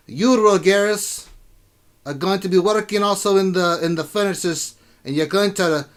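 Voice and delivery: rousing dwarf welcome speech, high and loud; dwarf king. Acted. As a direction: address recruits as a tough dwarven ruler.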